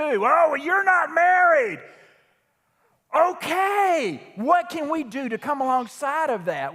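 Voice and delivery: preaching hard and loud, building passion; worked up preaching. Real, not acted. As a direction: let the sermon climb, pushing every line. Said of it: "I know I'm getting worked up".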